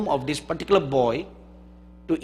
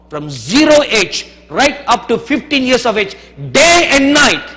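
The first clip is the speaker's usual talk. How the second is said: rising higher and louder to a shout; building preaching shout; 7 semitones higher, 9 dB louder than usual. Real, not acted. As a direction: build from strong to a full shout.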